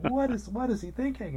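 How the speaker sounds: very high, incredulous mock outburst; announcers losing it. Chess fan imitating baffled announcers during a stalled game, frantic. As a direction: squeal it in exaggerated disbelief. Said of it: "they're just losing it".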